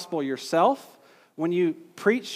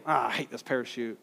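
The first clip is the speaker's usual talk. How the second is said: mock grumble; 5 semitones lower, 6 dB quieter than usual. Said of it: "You don't say".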